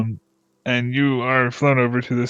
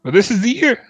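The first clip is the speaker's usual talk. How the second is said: much higher, louder, hopeful boast; excited villager; 5 semitones higher, 4 dB louder than usual. Acted. Villager boasting to the party about his pie record, excited.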